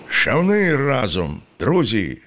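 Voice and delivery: high, drawn-out rallying call; narrated shout without much added volume. A narrator's reading. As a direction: urge the rowers on with a lifted, stretched cry. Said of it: "крикнув Карпо".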